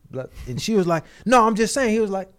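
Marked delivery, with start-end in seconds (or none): 1.3-1.9 higher, louder mock defensive retort; aggressive protest. Woman on the stand answers the lawyer back, mock aggressive. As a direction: push back hotly, imitating a testy witness. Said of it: "You seem kind of aggressive right now".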